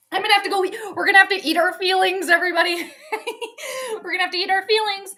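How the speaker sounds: real laughter through high-pitched words; cracking up. Real, not acted. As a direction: laugh while talking, voice riding up. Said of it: "I cracked myself up".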